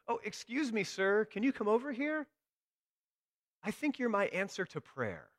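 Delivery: high, hopeful call to a stranger; high-pitched, imploring woman's voice. Retold or imitated. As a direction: hail him from afar, pitched well up. Woman calls stranger over.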